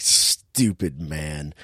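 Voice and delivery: low, soft, drawn-out disdain; slow muttered judgement on a fool; mock scorn. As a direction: stretch it out in quiet contempt. Scornful reaction to doomed character.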